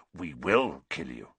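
low, menacing promise; growl. A narrator's reading. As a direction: growl it as a firm threat.

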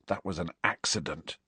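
soft and low, wretched; miserable whisper. A narrator's reading. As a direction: murmur it in misery. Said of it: "he whispered miserably".

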